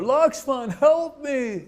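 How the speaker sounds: high, desperate call of a trapped man; mock cry for help. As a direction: cry out high for your brother to save you. Demon fakes Rama's cry for help.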